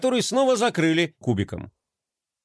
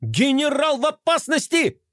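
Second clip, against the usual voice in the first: high alarmed squeal; shrieking; 10 semitones higher than usual. A narrator's reading. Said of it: "заверещал Мелкотрон".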